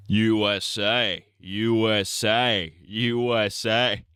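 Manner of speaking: slow, stretched mock-presidential chant; Trump impression. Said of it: "doing a Trump impression".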